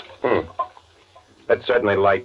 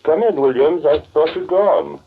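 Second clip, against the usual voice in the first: louder and higher, calling from the next room; 9 semitones higher, 4 dB louder than usual. Acted. Walter calls to the house man entering the study, raised voice. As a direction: call toward the door to the house man.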